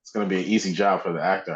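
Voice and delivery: ordinary talk, nothing marked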